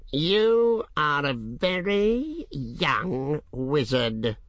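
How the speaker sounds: high, slow mocking dragon. A narrator's reading. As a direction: ancient dragon, amused and sly. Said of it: "the dragon said".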